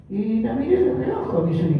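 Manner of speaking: usual speaking voice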